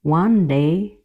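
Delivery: ordinary delivery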